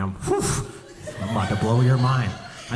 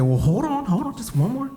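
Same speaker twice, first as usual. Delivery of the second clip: much higher excited hold on; mock eager stalling; frantic stalling; 14 semitones higher than usual. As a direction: rush to stop them.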